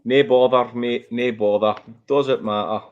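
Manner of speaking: put-on Scottish brush-off; Scottish accent. Shown as a demonstration. Presenter answering a viewer's request for an accent, put-on Scottish. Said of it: "that's as far as my Scottish goes".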